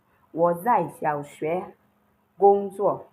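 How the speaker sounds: slow, clear, each syllable apart; slow model sentence. Shown as a demonstration.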